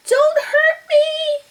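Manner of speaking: high, slow frightened whimper. Acted. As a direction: plead like a scared lion. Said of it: "he whimpered".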